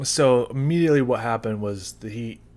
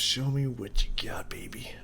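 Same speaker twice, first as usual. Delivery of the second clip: whispering; 2 semitones higher than usual. Real, not acted. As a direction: breathe it out as a taunt. Whispered taunt at the sauce.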